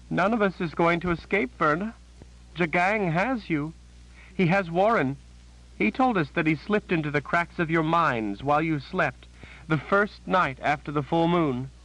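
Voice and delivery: high, shaken voice of a terrified sister; a scared woman blurting out bad news; frightened Janet. A narrator's reading.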